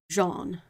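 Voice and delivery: Jean said slowly with a soft zh and no d before it; Jean starting on a gentle zh sound; soft French J. Shown as a demonstration. Showing a friend how the French J sounds, slow soft Jean. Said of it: "instead of being like John, you just go".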